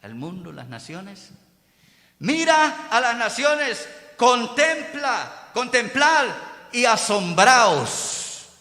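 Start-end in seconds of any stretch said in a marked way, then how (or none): 2.2-8.5 slow, loud, ringing command; God's proclamation. Acted. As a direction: proclaim each word with weight. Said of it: "Le dice".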